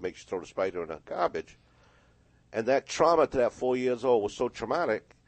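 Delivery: ordinary talk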